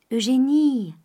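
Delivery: called out high and loud; calling out. A narrator's reading. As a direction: call her name across the room. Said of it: "cria la bonne mère". (appel à voix haute)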